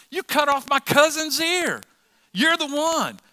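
very high, loud mock outrage; hammed-up indignant shout; acted angry accusation. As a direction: point out the culprit at full voice. Angry relative accuses Peter, acted.

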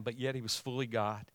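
usual speaking voice

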